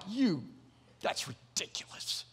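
scornful, dismissive brush-off; a slow, scornful you, that's ridiculous; mock scoff. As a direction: scoff at it like a sneering Pharisee. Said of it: "That's my imitation of a scoff".

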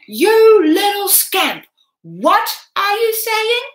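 high, loud, slow furious outburst; enraged. A narrator's reading. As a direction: explode with rage at the boy.

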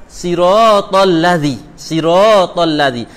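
'siratal ladzi' slow, with no long a; 'ladzi' spoken slowly and clipped; wrong Arabic. Shown as a demonstration.

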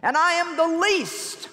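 "I" lifted high and slow, whimpering self-pity; mock pleading emphasis. Shown as a demonstration. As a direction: stretch "I am the least" up high like a wimp. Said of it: "that spirit of diminishment".

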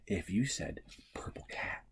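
soft unvoiced whisper; hushed guessing-game whisper. Real, not acted. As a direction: breathe the words like a secret.

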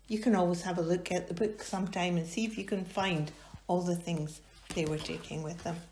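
usual speaking voice

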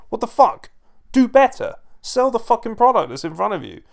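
high, loud, angry demand; exasperated outburst. Real, not acted. Fan berating commentators for not selling the product, exasperated.

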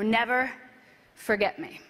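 ordinary talk, nothing marked

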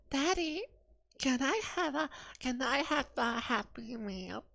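put-on high, soft little-kid voice; pleading child. Child asking daddy for a Happy Meal, high soft pleading. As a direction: beg like a small child, drawing out the end.

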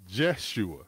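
Jeshua begun with an English J and "e"; J-E pronunciation. Shown as a demonstration. Teacher showing the changed name, Jeshua said with J-E. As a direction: say Jeshua starting "Jesh". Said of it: "with the J E".

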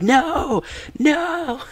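high-pitched drawn-out wail, half laughing; mock screamed plea; retold scream. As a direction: imitate a man screaming in protest, very high. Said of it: "he was screaming".

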